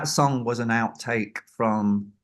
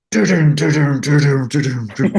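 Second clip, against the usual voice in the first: sung doo-doo drum beat, louder; vocal drum imitation; 4 semitones higher, 6 dB louder than usual. Storyteller vocalises the famous drum sound, louder. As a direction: mouth the big gated drum hit.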